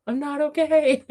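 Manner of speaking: high-pitched squeal of fangirl overwhelm; excitement spilling over into a high cry; giddy overwhelm. Real, not acted. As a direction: let it climb high, about to burst out laughing. Repeats it, escalating into laughter.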